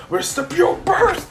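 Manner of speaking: high urgent call. Retold or imitated. Zuko urges Ida to attack, high urgent call. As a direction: hurry him into the attack.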